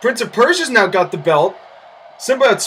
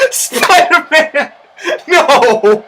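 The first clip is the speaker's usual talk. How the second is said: helpless laughter, high and loud; laughing; 6 semitones higher, 3 dB louder, much slower than usual. Real, not acted. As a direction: crack up at the botch. Bursts out laughing at the botch.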